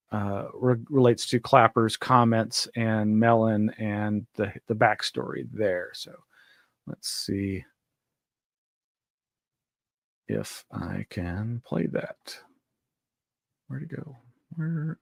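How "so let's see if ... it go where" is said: very soft, distracted search talk; muttering to self. Real, not acted. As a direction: murmur absently while hunting for a file. Hunting for a clip to play.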